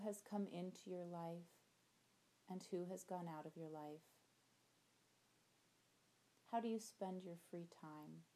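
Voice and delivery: gentle visualization voice, soft endings; guided meditation. Acted. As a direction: guide them through the imagery calmly.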